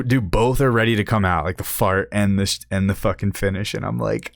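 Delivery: ordinary talk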